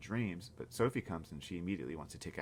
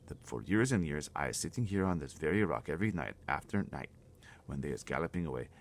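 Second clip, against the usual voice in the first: usual speaking voice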